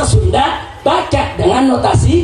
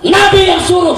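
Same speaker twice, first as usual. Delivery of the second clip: forceful preaching; 3 semitones higher than usual. Real, not acted. Preacher proclaiming the hadith on Arabic style, forceful preaching.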